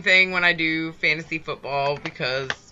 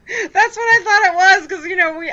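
laughing; 12 semitones higher, 5 dB louder than usual. Real, not acted. Laughing embarrassed admission.

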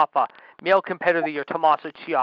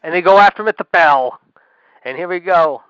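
excited wrestling call, louder at the opening; live play-by-play; 7 dB louder than usual. Real, not acted. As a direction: announce the bell like a ringside commentator. Calls match as it starts.

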